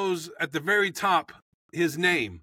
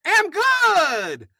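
high, loud, beaming boss; a jolly king at the top of the pole; Supreme Master voice; 13 semitones higher, 7 dB louder, slower than usual. Acted. Supreme Master answers his recruit, high and cheery. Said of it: "That's just the voice that happened".

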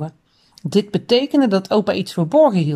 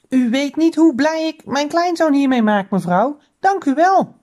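higher, mildly overjoyed grandfather; delighted thanks; 7 semitones higher than usual. A narrator's reading. Grandfather thanking the woman for his grandson's bird, delighted. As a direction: thank someone with lifted, happy pitch.

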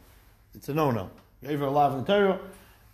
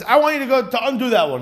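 raised, louder voice of an insistent wife; lightly voiced demand, pitched up and pressed; 6 semitones higher, 5 dB louder than usual. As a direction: act out a wife making her demand. Voicing the wife's demand to husband.

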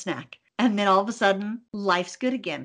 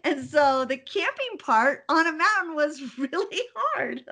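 laughing; 6 semitones higher than usual. Real, not acted. Laughs through camping story.

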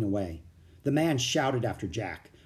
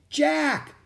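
much higher cry of his name; 14 semitones higher, 4 dB louder than usual. A narrator's reading. Annie crying out to her brother, louder. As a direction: call across to him from the boat. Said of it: "came a cry".

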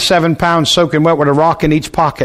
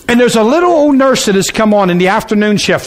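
usual speaking voice

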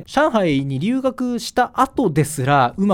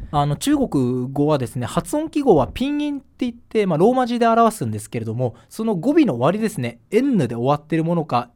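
normal talking voice

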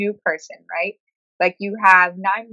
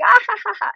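mock laugh; 14 semitones higher, 6 dB louder, much faster than usual. People laughing off her confession.

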